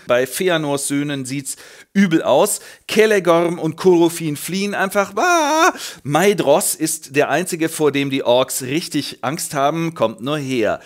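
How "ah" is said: high panicked yelp, louder; mock scream. Fleeing elf lords, high comic panic scream. Sons of Feanor flee.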